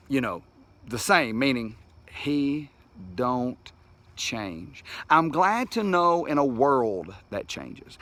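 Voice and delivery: unhurried, pausing between phrases like a closing sermon; slow reflective preaching. Acted. Pastor closing a devotional to his viewers, slow earnest preaching. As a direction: let each line land, slow and earnest.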